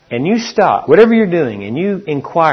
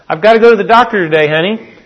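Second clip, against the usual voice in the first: brighter, higher, faster wife voice, louder; wife's voice; 6 semitones higher, 5 dB louder, faster than usual. Retold or imitated. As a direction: play the eager wife announcing her news. Wife telling husband her plans.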